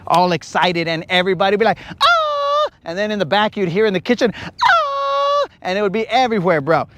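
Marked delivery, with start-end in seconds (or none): 2.0-2.7 high falsetto grito yell; a quick high "ah" cry; imitated grito. 4.3-5.5 high yelling grito from the kitchen; a high "ah" grito cry; imitated distant grito. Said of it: "Why are they all yelling".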